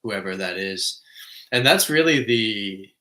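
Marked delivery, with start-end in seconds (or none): 1.5-2.9 emphatic. Real, not acted. Emphatic closing point.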